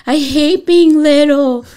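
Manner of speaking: put-on high, loud, slow whining little girl; whiny child. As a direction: drag out the complaint like a sulky kid. Mother voices daughter complaining.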